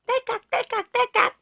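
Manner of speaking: high playful click noises; high clicking sound. Acted. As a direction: click like a toy thermometer. (높은 딸깍 소리 흉내)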